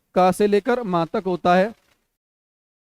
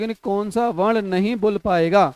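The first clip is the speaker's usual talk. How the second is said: usual speaking voice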